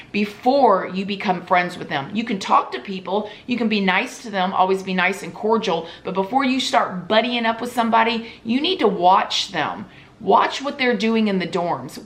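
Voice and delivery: ordinary delivery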